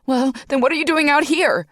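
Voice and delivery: high loud demand; mother demanding answers from nurse. A narrator's reading. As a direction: snap the question. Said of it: "she demanded".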